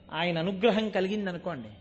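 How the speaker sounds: ordinary talk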